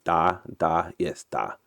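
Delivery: trying out a Russian accent; soft practice run of a Russian accent; quietly warm up the Russian accent. Shown as a demonstration. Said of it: "uh, my Russian accent".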